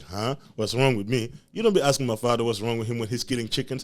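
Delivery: outraged back-talk, rising; indignant kid. Retold or imitated. Kid arguing back at the housekeeper, rising outrage. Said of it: "I'm like".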